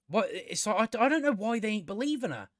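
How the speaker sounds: ordinary delivery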